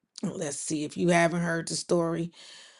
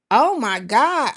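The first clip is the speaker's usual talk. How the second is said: retold shock; 5 semitones higher, 6 dB louder than usual. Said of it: "And I was like".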